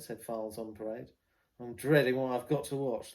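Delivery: a loud working-class London ballad read; loud working-class London recitation. Acted. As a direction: declaim it like a soldier on the parade ground, London accent. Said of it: "put on a working class accent".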